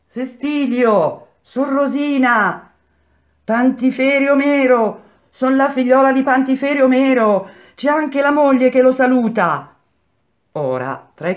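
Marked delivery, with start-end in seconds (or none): 0.1-9.7 women shouting. A narrator's reading. Relatives greeting jailed men by name, shouting. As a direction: call across to the cells.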